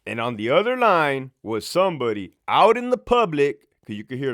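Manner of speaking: ordinary delivery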